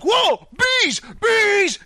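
very high panicked imitation; mock freaking out. Flipper finding bees in the shed, mock panic. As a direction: yelp it high like a man fleeing. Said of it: "he starts freaking out".

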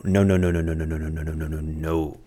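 "no, no, no" pressed hard, then trailing down softly; emphatic repeated no. Real, not acted. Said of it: "I hope that came across pretty well in the audio".

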